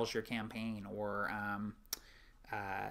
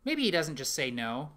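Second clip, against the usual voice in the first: ordinary talk, nothing marked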